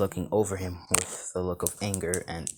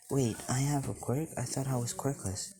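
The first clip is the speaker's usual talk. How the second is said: put-on higher, puzzled boy's voice; surprised questioning; 4 semitones higher, 4 dB quieter than usual. Boy learns he has a quirk, higher surprised voice. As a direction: raise the pitch in soft disbelief.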